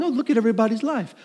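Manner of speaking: fast, airy mock Facebook browsing; a light, breezy mock scroll; carefree voice of someone scrolling a feed. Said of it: "You're, like, just careening along".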